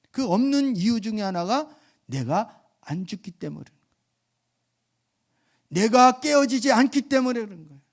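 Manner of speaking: high, loud fervent preaching; full-force preaching. Real, not acted. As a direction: drive the point home from the pulpit. (높고 크게 열정적으로 설교하듯)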